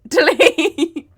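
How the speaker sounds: high and loud, laughing through the word; laughing delete. Real, not acted. She orders her slip cut again, laughing loudly. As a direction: crack up while ordering the cut. Embarrassed at own slang.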